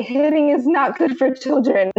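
put-on high lecturing kid voice; imitating a little boy. Mother imitating her son lecturing, high kid voice. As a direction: play a small boy lecturing like a grown-up. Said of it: "loves to say".

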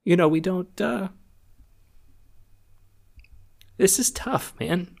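high, halting voice, really near tears; choked up. Real, not acted. Admitting this is tough after walking others through it, choked up. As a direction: struggle to talk through a tight throat. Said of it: "sorry, I choked up there".

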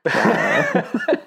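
high, playful dinosaur rawr with a laugh; dinosaur roar. Pretend. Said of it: "it should have been more like".

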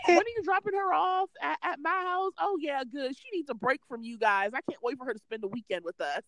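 high, fast, eager grandma imitation. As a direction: rush it like a grandma who can't wait. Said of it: "She's like".

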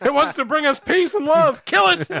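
hammed-up shout to kill the thing; mock panic shout. Mock panic about alien.

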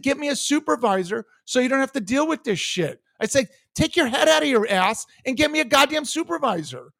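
high, heated demand; an irate caller demanding a supervisor; retold angry demand. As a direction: bark the order at the agent. Retold shouting at agent.

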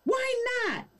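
hammed-up Karen outrage; indignant Karen. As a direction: squeak it out in disbelief.

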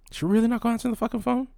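high, fast, stunned disbelief; exasperated high-pitched rant at the ringing phone. Real, not acted. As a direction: squeak it out, can't believe she won't pick up. Phone keeps ringing, no answer.

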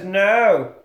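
loud, high put-on character voice; deranged Looney Bin Jim.

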